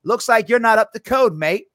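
a hint of a gruff Australian inspector; lightly voiced Australian building inspector; little Australian accent. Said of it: "with that little Australian accent".

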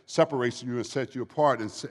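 ordinary talk

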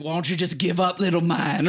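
mock a cocky Australian wrestler; hammy bad Australian accent; awful Australian accent. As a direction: taunt in a put-on Australian accent. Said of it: "in, in the accent".

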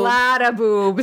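put-on slow, drawn-out Minnesotan accent. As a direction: stretch it out like a Minnesotan. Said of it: "in a thick Minnesotan accent".

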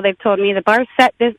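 ordinary delivery

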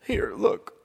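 put-on high, loud sulky child voice; hammed-up upset little boy; whining boy. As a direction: thrust it out in a bratty whine. Crying boy shows his bad present.

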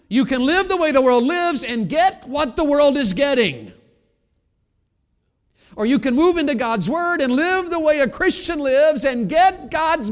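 loud, rising preacher's cry; full-force preaching. Acted. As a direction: preach it out to the whole room.

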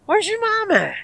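imitated neighbour calling out very high; high-pitched mock holler, falling at the end. Neighbour asking teller after the mom, high mock holler.

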